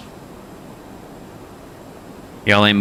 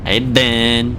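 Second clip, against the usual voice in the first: playful put-on New York greeting; New York accent; 3 semitones higher than usual. Streamer trying out the local greeting, New York accent. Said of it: "Time for the New York accent".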